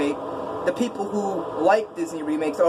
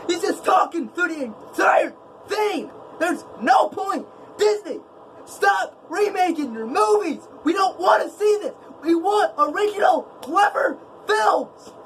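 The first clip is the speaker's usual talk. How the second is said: high, heated shouting at Disney remakes; angry rant; 10 semitones higher than usual. Real, not acted. Vlogger ranting at Disney about remakes, shouting angrily. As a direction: rant at the top of your voice, fed up. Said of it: "I'm getting way too angry".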